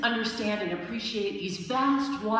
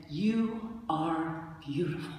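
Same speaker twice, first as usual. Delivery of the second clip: slow, quiet and lower, full of awe; soft admiring murmur; 5 semitones lower, 5 dB quieter, slower than usual. Retold or imitated. Admiring the grizzly bear, slow soft awe. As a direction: tell the bear it is beautiful, softly. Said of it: "instead I said".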